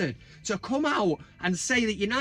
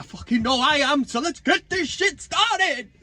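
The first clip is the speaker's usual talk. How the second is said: hyped up; 8 semitones higher, 7 dB louder than usual. Real, not acted. Kicking off the next segment, loud hyped yell. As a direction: fire up the crowd. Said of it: "Who's ready for a bit".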